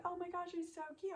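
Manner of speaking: quick, high mock gush; imitated gushing. Retelling a friend admiring the gnome, gushing and high.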